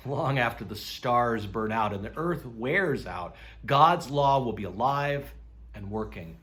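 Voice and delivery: ordinary talk, nothing marked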